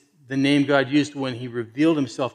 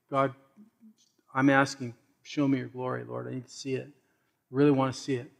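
earnest whispered-soft prayer; 3 semitones lower, 9 dB quieter than usual. Shown as a demonstration. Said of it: "pray that prayer and say".

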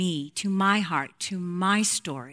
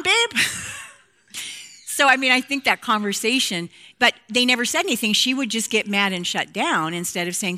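ordinary delivery

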